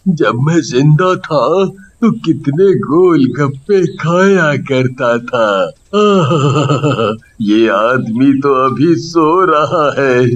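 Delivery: put-on a hungry old ghost plotting a snack; greedy fat ghost. (पेट भरने की सोचता मोटा भूत, गहरी धीमी आवाज़ में)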